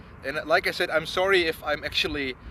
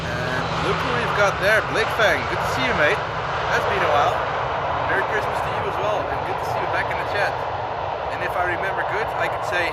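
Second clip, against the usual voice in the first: loud, higher voice over the roar; raised voice; 5 semitones higher, 8 dB louder than usual. Real, not acted. Streamer greets a returning chatter over jet roar, raised voice.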